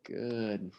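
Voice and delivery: ordinary delivery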